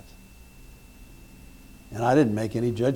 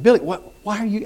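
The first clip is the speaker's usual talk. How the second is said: high-pitched baffled protest; retold startled questioning; 8 semitones higher, 5 dB louder than usual. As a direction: act out bewildered disbelief at a late caller. Acts baffled at midnight call.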